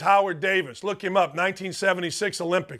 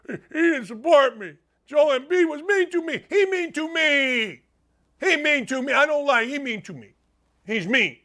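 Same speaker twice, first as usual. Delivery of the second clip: put-on higher, whining child voice; whiny sulking kid; 6 semitones higher than usual. Imitating Ben Simmons whining to Doc Rivers like a child. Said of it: "that's the voice I go".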